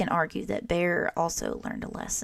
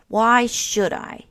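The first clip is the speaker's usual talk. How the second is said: slow, louder, grudging question; stubborn Bear; 4 semitones higher, 8 dB louder, slower than usual. A narrator's reading. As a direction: Bear drags out a sulky challenge. Sulky Bear refuses to listen.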